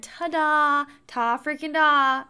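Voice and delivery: drawn-out sitcom boss fanfare; Michael Scott ta-da. Retold or imitated. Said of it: "in the words of Michael Scott".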